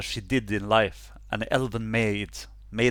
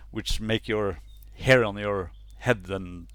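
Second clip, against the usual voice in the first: ordinary talk, nothing marked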